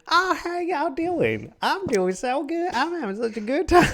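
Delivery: imitating a tipsy Louisiana charmer; drunk Louisiana drawl. Wife gone full Louisiana, imitated in high Louisiana accent. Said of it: "you see it slowly come out until it's full".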